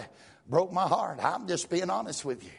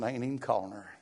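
quiet; 6 semitones lower, 5 dB quieter than usual. Real, not acted. Quiet private recollection.

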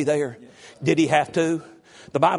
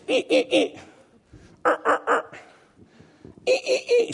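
mock bickering noises. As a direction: imitate a squabble in little wordless bursts. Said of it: "we're going like this".

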